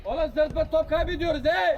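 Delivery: high-pitched shout across the pitch; scolding shout. Real, not acted. Player scolding teammates for losing the ball, exasperated shout. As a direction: yell at careless teammates, exasperated.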